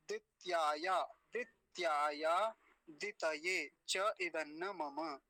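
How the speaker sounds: very slow Sanskrit model with long vowels; word-by-word recitation. Shown as a demonstration. Teacher models the mantra for students, slow word-by-word recitation. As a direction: recite each word measured, pausing for repetition.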